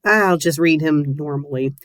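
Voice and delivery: usual speaking voice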